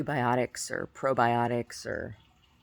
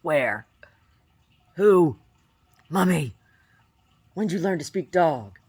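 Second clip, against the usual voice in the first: voicing the dog; 4 semitones higher, 9 dB louder, slower than usual. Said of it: "He's like".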